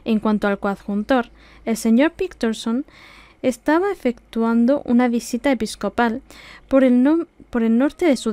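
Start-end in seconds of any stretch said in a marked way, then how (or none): none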